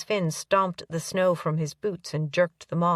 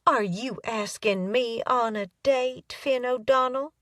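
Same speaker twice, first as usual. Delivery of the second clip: slow, higher, mock-coy Southern drawl; sarcastic Southern twang; 5 semitones higher than usual. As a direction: tease him in a put-on Southern twang. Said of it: "said in a sarcastic Southern twang".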